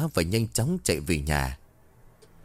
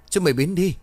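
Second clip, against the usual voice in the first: higher, louder panicked scream; terrified man screaming the repeat even louder; 8 semitones higher, 4 dB louder than usual. A narrator's reading. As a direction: scream it again even harder. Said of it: "Quý lại hét lớn".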